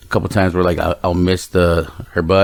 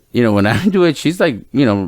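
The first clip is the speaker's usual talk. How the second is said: ordinary talk, nothing marked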